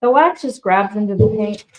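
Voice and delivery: ordinary delivery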